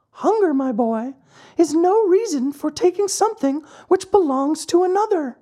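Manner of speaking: very high, tiny lecturing voice; moralizing glowworm. Acted. Glowworm lectures the puppet on stealing, very high. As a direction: lecture the boy in a squeaky-high creature voice.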